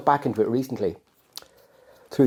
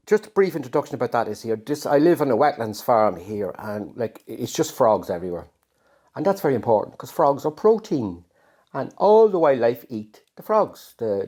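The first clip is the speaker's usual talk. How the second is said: ordinary delivery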